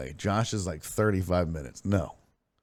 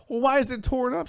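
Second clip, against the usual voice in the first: higher, exasperated question; husband demanding answers about the mess, exasperated; accusing himself. Retold or imitated. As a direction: push the blame back at her. Acted quarrel with Nora.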